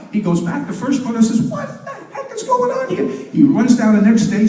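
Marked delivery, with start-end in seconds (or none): 1.4-3.1 high indignant squawk. Acted. First brother outraged at the pay, very high. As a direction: squeal it out up high.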